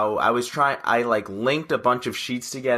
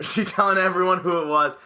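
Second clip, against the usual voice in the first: usual speaking voice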